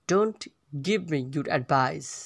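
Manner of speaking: slowly, with a pause after "Don't"; slow repeat. Shown as a demonstration. Teacher repeats phrase slowly in parts.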